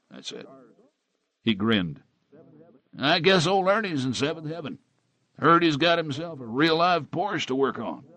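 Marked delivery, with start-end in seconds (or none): 3.0-8.0 higher, chatty small-town bartender; easygoing barman gossip; folksy bartender. Acted. Bartender character's voice.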